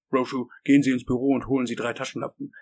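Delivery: loud, fast command; brisk order. Acted. Boss orders a man off.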